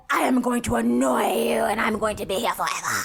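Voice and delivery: hammed-up crazy pest voice. Co-host acts out an annoying childhood phase, crazy voice. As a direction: drag it out like an endlessly annoying kid. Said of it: "let me put my crazy voice away".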